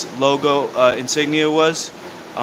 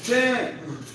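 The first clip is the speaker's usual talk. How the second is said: excited counting; 9 semitones higher, much slower than usual. Real, not acted. Counting push-ups aloud.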